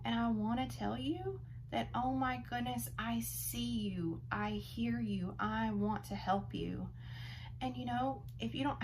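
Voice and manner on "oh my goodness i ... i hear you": normal talking voice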